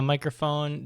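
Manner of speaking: usual speaking voice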